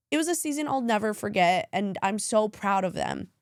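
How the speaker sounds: ordinary talk, nothing marked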